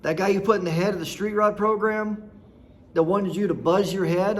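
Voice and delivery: usual speaking voice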